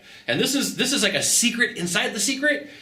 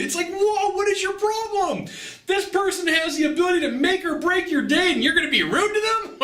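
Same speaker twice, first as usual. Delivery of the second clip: mock outrage; 12 semitones higher than usual. Mocking outrage at rude travelers.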